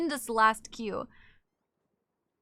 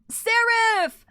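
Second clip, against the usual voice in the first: excited callout; 10 semitones higher, 9 dB louder than usual. Real, not acted. Calling out name.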